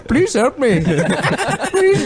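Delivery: put-on high, pleading Indian accent. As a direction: beg in a hammy Indian accent. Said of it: "doing an Indian voice".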